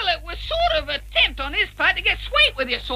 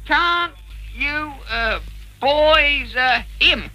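word by word, with long gaps; halting, piecemeal read-aloud; reading out haltingly; 4 semitones lower, much slower than usual. Acted. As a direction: read it as each word gets written. Reading words as they are written.

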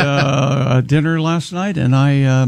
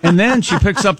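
usual speaking voice

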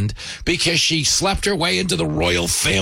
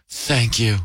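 mock teary thanks; 4 semitones lower, slower than usual. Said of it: "she starts crying and goes".

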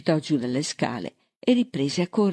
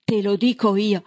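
raised, higher-pitched retort; narrated shouting; 6 semitones higher, 4 dB louder than usual. A narrator's reading. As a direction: snap back with rising temper. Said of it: "Ormai stava gridando".

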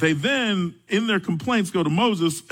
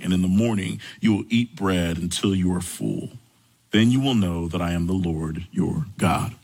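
low, measured Bible reading; scripture reading; 6 semitones lower than usual. Acted. Pastor reading Exodus aloud to the congregation, measured and low.

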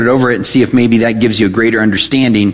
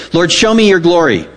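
ordinary talk, nothing marked

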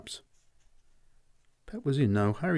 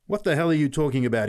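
fast, louder, higher angry shout; 6 semitones higher, 5 dB louder, faster than usual. Acted. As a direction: bark back at the boy. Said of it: "he shouted".

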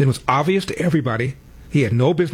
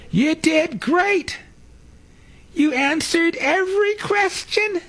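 high, slow, gushing mock voice; mocking Jill Biden; slower than usual. Host mocking Jill Biden praising Joe, high and slow. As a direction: gush it in a silly high voice. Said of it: "she tells the world".

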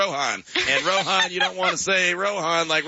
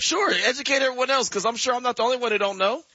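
sarcastic; 6 semitones higher than usual. Pretend. Teasing, sarcastic.

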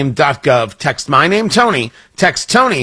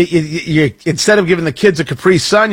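ordinary delivery

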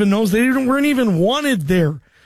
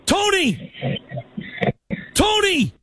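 calling out; 2 semitones higher than usual. Real, not acted. Host calling a caller who fell asleep on air, rising calls. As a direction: call out to wake him up.

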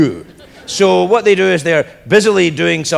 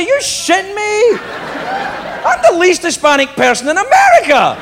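incredulous protest; 7 semitones higher than usual. Retold or imitated. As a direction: protest in comic disbelief, pitched right up. Protesting to Ricky about casting.

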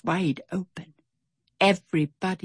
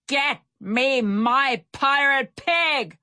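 slow, high pirate captain's shout; shouted demand; 8 semitones higher, slower than usual. A narrator's reading. Barracuda Bill demands his pig from the crew, shouting slowly. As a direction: demand it, each word stretched out. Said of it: "Barracuda Bill shouted from the railing".

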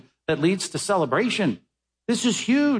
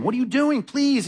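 higher, begging plea; pleading father; 8 semitones higher than usual. Retold or imitated. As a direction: beg the sulking son to come inside. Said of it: "begged him".

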